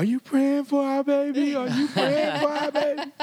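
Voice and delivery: hammed-up frantic shriek, very high and loud; mock freaking out. Husband confronted about praying for the baby, mock-hysterical shriek. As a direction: imitate a panicked mother wailing her plea. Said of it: "I was freaking out".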